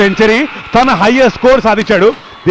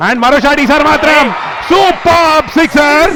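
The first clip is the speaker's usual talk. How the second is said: excited cricket commentary; 6 semitones higher, slower than usual. Real, not acted. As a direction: build up and shout the six. Live call of a six.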